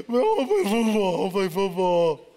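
loud, whiny complaint; whining plea. Retold or imitated. Player whines to God, loud whiny protest. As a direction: whine like a stubborn kid.

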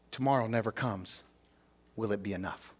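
hushed solemn close. Real, not acted. Pastor to congregation, hushed and solemn. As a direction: let it sink in quietly, almost to nothing.

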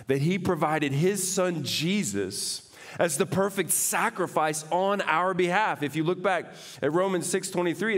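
high, loud, fervent preaching; full-force preaching. Acted. Pastor preaching to the congregation, fervent and loud. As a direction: preach it out to the whole room.

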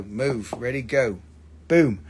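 ordinary talk

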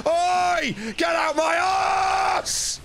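high, drawn-out enraged scream; full-throated high yell of frustration; real screaming. Real, not acted. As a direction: scream at the enemy, stretching out the words. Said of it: "crippling headache after screaming that".

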